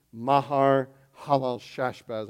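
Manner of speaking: "Mahar Halal Shashbaz" slowly, each syllable placed; carefully practiced name. Shown as a demonstration. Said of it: "I practiced that getting ready".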